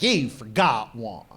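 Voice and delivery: low, slow croaky granny voice; a ninety-year-old woman scolding, slow and low. Retold or imitated. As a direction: speak like an angry old lady. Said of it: "She's like".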